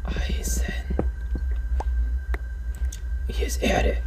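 soft breathy whisper. Real, not acted. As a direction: whisper the find. Quietly naming finds.

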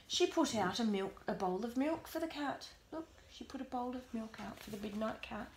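usual speaking voice

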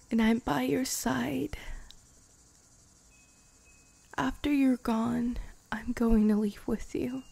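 tender whispered confession; intimate whisper. Acted. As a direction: confess it in a hushed breath. Whispered vow to die together.

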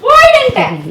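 high loud get out; shouting. Acted. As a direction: yell at him to leave. Wife throwing husband out.

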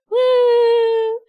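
high, loud sustained "woo"; excited whoop. Real, not acted. Streamer showing off new model, excited whoop. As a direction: let out a long gleeful whoop.

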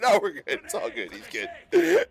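high, cracking with laughter; forced reassurance through laughter. Acted. To the team while a teammate gets slammed, laughing reassurance. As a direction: insist it's fine while laughing.

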